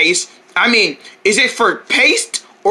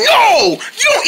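sharp no; 13 semitones higher, slower than usual. Acted. Stops friend eating toothbrush.

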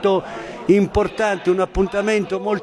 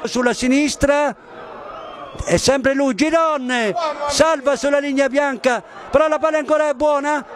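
excited commentary; 7 semitones higher than usual. Real, not acted. Live play-by-play.

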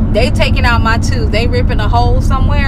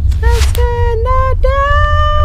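groggy from anesthesia; much slower than usual. Retold or imitated. Imitating a drugged kid.

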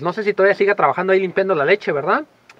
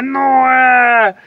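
higher, long shouted name; terrifying yell; 6 semitones higher, 8 dB louder than usual. Retold or imitated. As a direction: scream it to catch a thief. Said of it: "un gritote bien aterrador". (grito aterrador y agudo)

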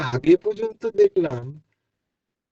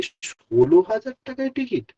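high-pitched disbelief at the price; astonished question; 8 semitones higher than usual. Retold or imitated. Student stunned by the roommate's news, asking in astonishment. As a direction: ask it as if you can't believe your ears. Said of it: "আমি অবাক".

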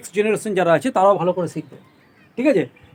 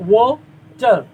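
Water split as wa, tar, louder; syllable by syllable; 6 dB louder, much slower than usual. Shown as a demonstration. Teacher counting syllables for pupils, water broken in two, loudly. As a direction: punch out water in two separate beats. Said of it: "W এ ও একটা উচ্চারণ হচ্ছে".

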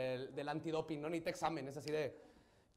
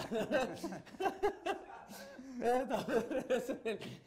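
high, long burst of laughter; real high-pitched laughing fit; hearty laugh; 7 semitones higher than usual. Real, not acted. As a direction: crack up helplessly for a few seconds. Laughing at own opening banter.

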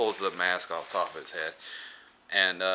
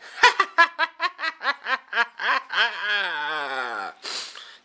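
Crypt Keeper cackle; 12 semitones higher than usual. Acted. Host introducing Tales from the Crypt, villainous cackle. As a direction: shriek with villainous laughter.

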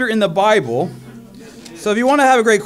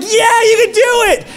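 high, loud laughing crowd yell; cheering crowd; 11 semitones higher, 3 dB louder than usual. Retold or imitated.

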